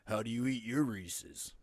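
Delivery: slow commercial-voice impression; Will Arnett. Host tries the actor's candy commercial, slow impression. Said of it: "he does the Reese's Pieces commercials too".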